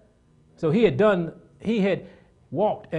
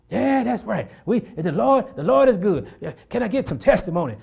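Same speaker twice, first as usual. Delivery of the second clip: mock-eager, raised voice; eager churchgoers; 5 semitones higher, 5 dB louder than usual. Preacher mimics Bible study members agreeing and calling for testimony, mock-eager. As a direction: imitate a fired-up testimony meeting.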